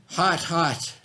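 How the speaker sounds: usual speaking voice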